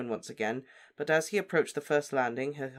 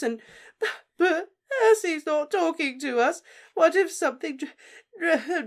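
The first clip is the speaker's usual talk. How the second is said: very high, loud sobbing with broken words; a stammering torrent of tears; weeping; 14 semitones higher, 10 dB louder than usual. Acted. Mother weeps out her fears for the family, high and loud.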